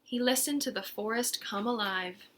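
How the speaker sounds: ordinary delivery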